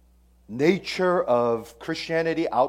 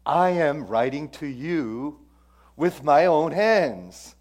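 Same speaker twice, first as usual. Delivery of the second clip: slow, louder, rising declamation of the verse; solemn scripture reading; 3 semitones higher, 8 dB louder, slower than usual. Acted. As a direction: read the apostle's words slowly and grandly. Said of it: "he begins his closure like this".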